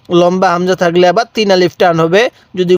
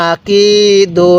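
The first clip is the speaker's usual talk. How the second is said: আকিদু very slow, কি held long and level; one-alif elongation; much slower than usual. Shown as a demonstration. Teacher showing how to read, drawn-out আকিদু. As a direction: chant আকিদু, stretching the middle কি. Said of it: "আমার সাথে সাথে পড়ুন".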